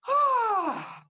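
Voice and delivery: high, loud settling "ah" breath; relieved sigh. Shown as a demonstration. Medium pauses her audience before working with spirit, a loud high "ah". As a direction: let it all out in one high "ah". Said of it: "let's have a moment to just go".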